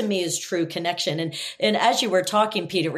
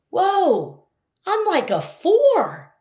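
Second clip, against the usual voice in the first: surprised admission; 10 semitones higher, 4 dB louder, much slower than usual. Retold or imitated. Leader admits struggling in meeting.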